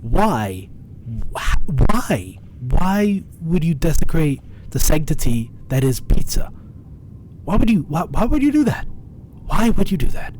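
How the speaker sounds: over-the-top indignant pleading; shaking with anger. Pretend. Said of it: "I'm only doing this as a meme".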